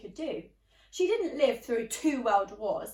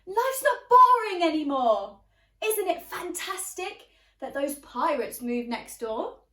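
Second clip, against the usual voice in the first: high, loud, eager outburst; excited blurt; 5 semitones higher, 9 dB louder than usual. Acted. Girl telling her parents about the new pirate neighbours, excited. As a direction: burst out with delight. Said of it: "Tilda blurted".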